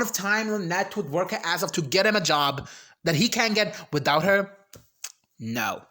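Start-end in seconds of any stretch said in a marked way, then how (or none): 5.4-5.8 whispered no. Real, not acted. Overwhelmed aside.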